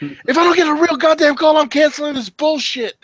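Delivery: high, loud mock rant; fed-up outburst. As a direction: vent at the telemarketers, fed up. Retold fed-up business owner.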